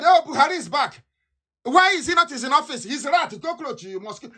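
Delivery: high, sneering mimicked chatter; mock gossiping critics. Mocking critics who claim Buhari is back, high sneering mimicry. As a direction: parrot the rumor-mongers with scorn.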